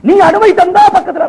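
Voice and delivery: high, ringing plea; impassioned preaching. Acted. Preacher voicing Umar asking permission at the Prophet's tomb, impassioned. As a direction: call it out high, phrase by phrase.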